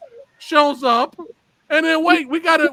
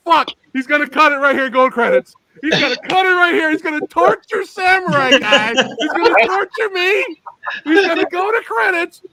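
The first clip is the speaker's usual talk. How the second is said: higher, louder, frantic running commentary; retold excited panic; 6 semitones higher, 3 dB louder than usual. A fan watching the twist, frantic and higher. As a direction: spiral into mock panic on the couch.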